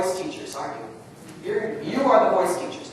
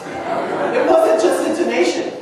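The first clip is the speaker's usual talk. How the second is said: laughing; 2 semitones higher, 5 dB louder than usual. Real, not acted. Praising choir, laughing.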